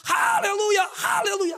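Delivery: high, loud victory shout; teriakan haleluya. Retold or imitated. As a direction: shout a triumphant hallelujah at full force. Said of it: "Saya teriak". (berteriak lantang penuh kemenangan)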